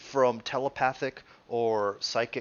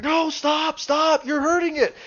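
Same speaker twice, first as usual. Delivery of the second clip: high, loud cries to stop; mock frantic plea; 8 dB louder than usual. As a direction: act out a player yelling in protest. Player protesting the dismembering.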